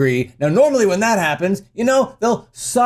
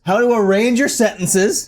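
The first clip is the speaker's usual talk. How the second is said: ordinary talk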